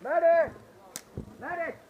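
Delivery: man down shout. Real, not acted. Downed player calling.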